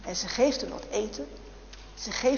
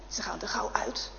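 hushed, breathy whisper; whispered narration; 7 dB quieter than usual. A narrator's reading. Storyteller to children as the guards hurry out, whispering. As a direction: whisper it like a secret escape.

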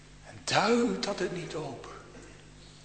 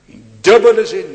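loud, high declaration; preaching emphasis; 5 semitones higher, 16 dB louder than usual. Real, not acted. Preacher proclaiming to the congregation, loud. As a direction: drive the point home loudly.

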